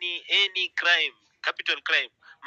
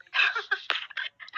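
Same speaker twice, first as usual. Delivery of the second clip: breathless high laugh; a burst of real laughter; airy laugh; 3 dB quieter than usual. Real, not acted. Host laughing at the German Kenyan joke, airy and high.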